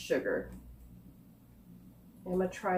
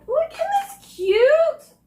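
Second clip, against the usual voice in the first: very high, loud drawn-out squeal; gushing coo; 11 dB louder, much slower than usual. Real, not acted. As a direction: coo over something adorable, stretched way up high. Cooing over the cute candy.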